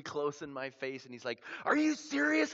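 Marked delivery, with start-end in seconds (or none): 1.6-2.5 loud, very high-pitched challenge; intense confrontation. Retold or imitated. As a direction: get in someone's face, incredulous. Man confronts him up close.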